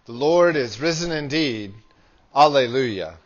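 usual speaking voice

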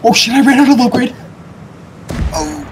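real alarm shouted out; panicked. Real, not acted. Runs out of fuel midair.

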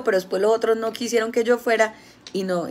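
usual speaking voice

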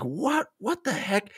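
mock confused What the heck?; baffled. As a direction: scratch your head at the page. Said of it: "at first I was like".

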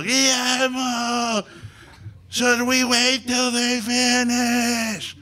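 high, slow, drawn-out shout; yelling over noise. Retold or imitated. Shouting at Guillermo over leaf blowers, high drawn-out yell. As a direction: stretch every word to be heard over the blowers. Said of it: "So I yell at Guillermo".

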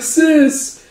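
put-on high-pitched small boy; little brother. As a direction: pipe up like a kid brother. Little brother calls his sister.

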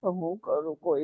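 a very soft, helpless question through tears; barely gets it out while sobbing; crying. Real, not acted. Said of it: "હવે રડવાનું તમે બંધ કરી દો".

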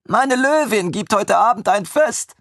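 put-on high, proud announcement by the young servant; announcing. A narrator's reading. Armin proudly announces his lioness's feast to his former lord, high. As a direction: proclaim the feast grandly, pitched high. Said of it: "verkündete er".

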